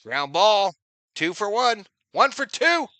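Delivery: loud, high ballgame call; excited play call. Real, not acted. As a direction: call the grounder like an announcer.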